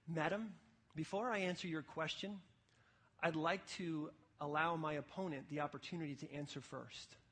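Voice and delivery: quiet, measured, thoughtful reply; Ravi Zacharias. Retold or imitated. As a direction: imitate a courteous debater, softly and with pauses. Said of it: "Ravi Zacharias quietly and thoughtfully says".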